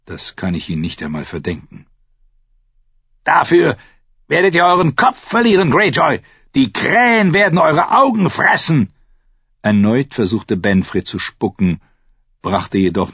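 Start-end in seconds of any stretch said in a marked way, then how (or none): 3.2-8.9 high, loud defiant shouting; furious threat. A narrator's reading. Captive promises Greyjoy death, shouting furiously. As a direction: yell it as a dire promise.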